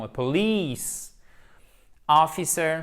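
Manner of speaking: A police officer very slow, police stressed on the long i. Shown as a demonstration. Teacher modelling a police officer slowly for the class. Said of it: "Ici, c'est un I long".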